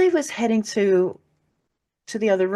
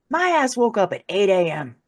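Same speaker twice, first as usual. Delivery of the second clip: ordinary delivery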